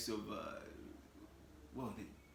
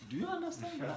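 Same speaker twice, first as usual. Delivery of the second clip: laughing question; 5 dB louder than usual. Retold or imitated. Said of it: "I'm like".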